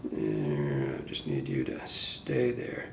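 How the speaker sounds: low, soft coaxing murmur; murmuring to the fly. Real, not acted. Telling the hackle to stay there, a low coaxing murmur. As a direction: coax the thread into staying put.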